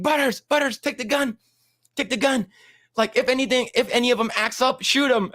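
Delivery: high urgent cartoon kid voice; Cartman ordering. Cartman handing Butters a gun, high urgent voice. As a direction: push the gun on him, high. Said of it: "He's like".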